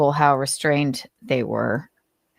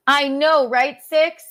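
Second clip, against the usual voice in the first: pet talk; 9 semitones higher, 3 dB louder, slower than usual. Real, not acted. Talking to her cat.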